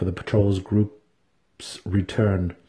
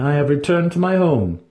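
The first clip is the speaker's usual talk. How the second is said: higher, louder haughty priestess; proud priestess; 7 semitones higher, 4 dB louder than usual. Acted. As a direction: speak as a proud high priestess. Vienna's voice in the story.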